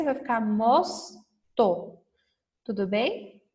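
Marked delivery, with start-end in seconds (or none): 0.0-2.0 slow and clear. Shown as a demonstration. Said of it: "Se eu colocar o S".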